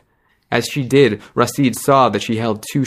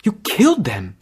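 shocked; 8 semitones higher than usual. Acted. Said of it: "she said".

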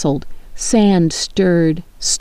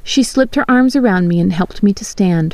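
ordinary delivery

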